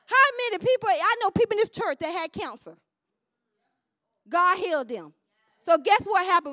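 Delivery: loud, high exhortation; full-force preaching. Real, not acted. Preacher testifying to the congregation, shouted preaching.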